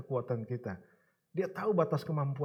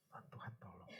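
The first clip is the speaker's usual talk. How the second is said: breathy whispered awe; whispered, low thanks to God; 5 semitones lower, 20 dB quieter than usual. Acted. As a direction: hush it to a whisper. Quiet testimony of God's help.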